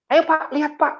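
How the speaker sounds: high-pitched, eager invitation; eager urging. Retold or imitated. Building agent urges the pastor to view it, eager urging. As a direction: urge them to come and look.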